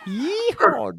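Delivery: a high, loud whooping yeehaw; cowboy yeehaw. Pretend. Host whoops about next week's hunting, high and loud. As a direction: let out a wild cowboy whoop.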